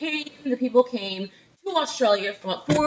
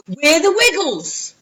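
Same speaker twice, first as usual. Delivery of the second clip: loud, slow put-on Australian accent; big children's-show greeting in a mock Australian accent; 4 semitones higher, 4 dB louder, slower than usual. Teacher imitating an Australian kids' band, loud hammed-up Aussie accent. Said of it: "think about what they sound like".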